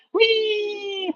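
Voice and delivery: high squealed whee; a silly gleeful squeal as you fold; playful whee. Acted. Playful slide down demo.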